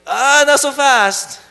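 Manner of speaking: a hammed-up crafty brother; sly bargaining. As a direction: dangle the stew, make him wait. Jacob bargaining with hungry Esau.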